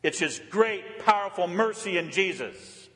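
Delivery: ordinary talk, nothing marked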